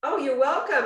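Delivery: ordinary delivery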